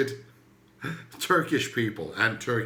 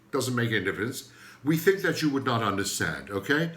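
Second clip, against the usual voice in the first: normal talking voice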